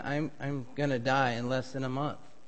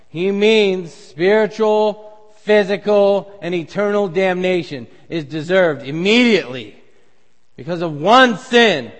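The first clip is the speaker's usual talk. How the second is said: preaching hard; 4 semitones higher, 10 dB louder than usual. Acted. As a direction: drive each word home. Preacher presses the point.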